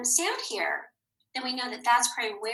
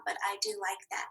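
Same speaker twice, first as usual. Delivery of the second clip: whisper; 3 semitones lower, 9 dB quieter than usual. Real, not acted. Personal aside about garlic.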